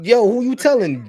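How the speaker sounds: loud, high, fired-up agreement; excited. Real, not acted. Friend agreeing about upstate towns, excited. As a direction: jump in excitedly.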